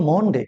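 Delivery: মন্ডে with a rounded "o", "mon-de"; mispronounced Monday. Shown as a demonstration. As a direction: say মন্ডে like "MON-day", vowel as in Bengali mon. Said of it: "কি বলি আমরা?". (মন্ডে-র ভুল উচ্চারণ, "মন-ডে")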